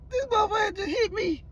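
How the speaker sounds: high cracked laugh-squeal of disbelief; squeaky laughing disbelief. Retold or imitated. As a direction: squeal your shock half laughing. Retelling his own shock at slap.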